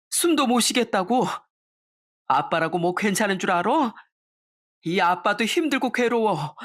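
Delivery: father finally weeping in front of his daughter; father sobbing. Acted. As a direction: break down crying. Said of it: "처음 아빠가 엉엉 우시며".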